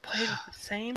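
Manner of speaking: whispered to himself. Real, not acted. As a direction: whisper the hint back. Repeating hint to himself.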